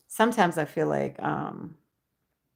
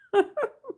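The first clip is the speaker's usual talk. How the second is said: high giggle. Real, not acted.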